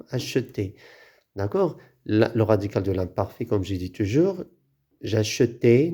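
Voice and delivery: normal talking voice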